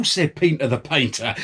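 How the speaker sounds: ordinary delivery, nothing marked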